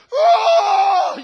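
a sudden high, loud shout of "Oh!"; worked-up outburst. Retold or imitated. Preacher mocking people who get worked up to speak truth, mock shout. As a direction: blow up in mock emotion. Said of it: "gotta get emotional and worked up".

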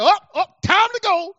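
high startled mock exclamation; mock alarm. On a call with wife and daughter, high mock alarm. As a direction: jump up high in fake alarm at the tears. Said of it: "I'll be like".

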